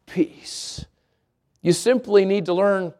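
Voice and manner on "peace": whispered peace. Acted. Ending Isaiah verse reverently.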